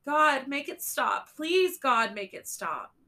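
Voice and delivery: anguished high cry; cried out. A narrator's reading. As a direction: scream it out in pain. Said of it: "cried out".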